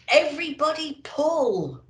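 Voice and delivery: rally the team to heave; rallying shout. A narrator's reading. Santa urging the reindeer to pull, shouting.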